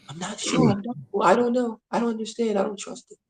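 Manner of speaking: small, high put-on voice of self-doubt; doubtful, insecure. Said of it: "Doubt, insecurity. How does that sound?".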